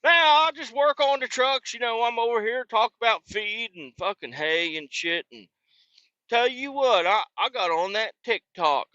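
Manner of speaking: high, put-on old country man voice; squeaky high old-timer bragging about TikTok; old man in the boonies. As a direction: ham up the rambling old-timer. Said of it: "He's an old man in the boonies".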